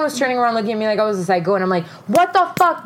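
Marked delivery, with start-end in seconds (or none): 2.1-2.9 high-pitched re-enacted outburst; mock freak-out, voice shooting up; losing it. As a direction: burst out in outraged disbelief. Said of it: "I lost my shit".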